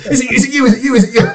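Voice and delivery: squeaky hopeful fan begging for an answer; eager fan voice. Retold or imitated. Fans on Twitter pestering the composer, high squeaky pleading. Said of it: "Like this".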